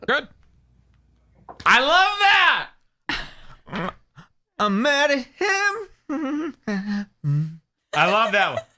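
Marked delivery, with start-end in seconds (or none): 1.7-3.9 delighted squeal. Real, not acted. Host thrilled by the crying clip, delighted squeal. 4.6-6.6 very high, very slow, whiny mock sob; hammed-up blubbering, mocking the crier; mock crying imitation. Host mocking a crying guest, hammed-up whimper. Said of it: "that's real tears".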